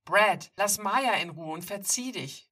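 loud, firm demand to back off. Acted. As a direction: tell the bully off, standing his ground. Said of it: "fordere ich ihn auf".

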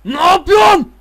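yelling high and loud at the players; real frustrated shout at the screen; angry shout. Real, not acted. Gamer yelling at his football players, furious shout. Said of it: "Bağırttırmayın beni akşam akşam".

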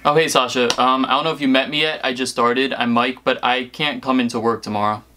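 ordinary talk, nothing marked

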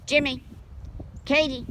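loud, high call across the yard; calling the dogs. Real, not acted. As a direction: call out to the dogs far away.

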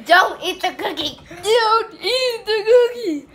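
laughing through burning mouth; mouth burning from spicy food. Real, not acted. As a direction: sputter it out while the mouth burns. Mouth burning from hot sauce.

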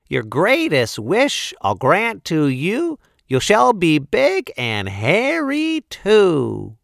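high, slow rhyming fairy spell; sing-song a spell like a tiny fairy; tiny tinkling fairy voice. Acted. Fairy casting the final spell.

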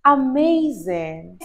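ordinary delivery

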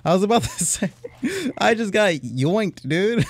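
high, loud, laughing; laughing disbelief. Real, not acted. Gamer laughs to viewers after getting robbed. Item snatched.

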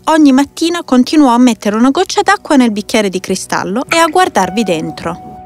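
ordinary delivery

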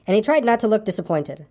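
usual speaking voice

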